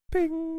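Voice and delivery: high, soft sound-effect ping. Shown as a demonstration. Announcing a picture popping up, with a high ping. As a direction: chirp it like an on-screen pop-up. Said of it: "flashing up picture".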